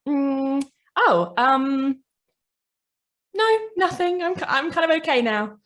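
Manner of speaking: put-on high, hesitant child voice; shy child. Child who has been seen, high and bashful. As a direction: stammer it out like a suddenly shy child. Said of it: "The child kind of just goes".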